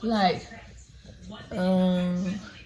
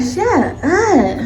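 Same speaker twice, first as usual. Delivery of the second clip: shut up; 8 semitones higher, 3 dB louder than usual. Retold or imitated. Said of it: "I'm like".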